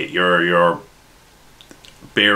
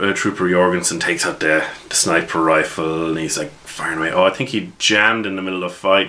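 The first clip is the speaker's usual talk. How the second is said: ordinary delivery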